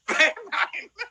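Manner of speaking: high burst of laughter; laughing hard. Real, not acted. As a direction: break into laughter. Reacting to magic trick.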